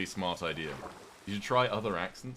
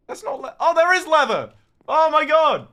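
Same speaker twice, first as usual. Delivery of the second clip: high, loud delighted squeal; excited shriek; 15 dB louder than usual. Real, not acted. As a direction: squeal with sudden joy. Finds leather, shrieks with joy.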